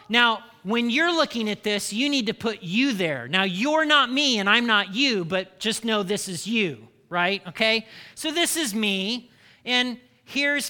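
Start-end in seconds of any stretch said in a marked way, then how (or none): none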